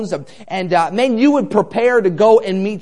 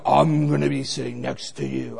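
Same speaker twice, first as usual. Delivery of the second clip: low, soft wrestler voice imitation; deep voice; 8 semitones lower, 6 dB quieter than usual. Big man claiming the seat next to him, deep voice. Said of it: "in that deep voice".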